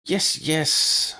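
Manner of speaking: high, clipped impatience; impatient villainess. A narrator's reading. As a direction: brush him off, high and loud. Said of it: "with obvious impatience".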